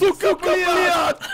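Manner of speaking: put-on very slow Russian-accented shout aping an enemy; mock Russian swear. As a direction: ham up a Russian gamer yelling. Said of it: "Hij praat Russisch tegen je".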